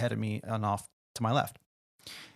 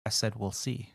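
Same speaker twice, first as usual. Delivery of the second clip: curt repeat; 3 semitones lower, 6 dB quieter than usual. Retold or imitated. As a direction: say it with cold attitude. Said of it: "He goes".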